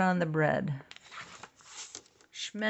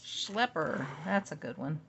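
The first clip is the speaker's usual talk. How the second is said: usual speaking voice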